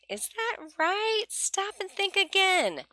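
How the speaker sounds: high, slow, coaxing classroom voice; high slow teacher voice. Shown as a demonstration. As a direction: prompt a child to think it over, slowly. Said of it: "I use this as a stop and think about it moment".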